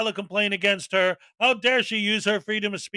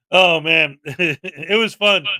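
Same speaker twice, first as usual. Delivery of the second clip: ordinary talk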